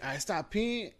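ordinary talk, nothing marked

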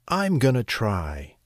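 small goat putting on courage; a touch of plucky resolve; mildly brave. A narrator's reading. Little goat to his brothers before crossing, mildly brave. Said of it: "said the little Billy Goat Gruff bravely".

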